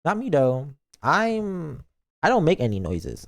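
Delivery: ordinary talk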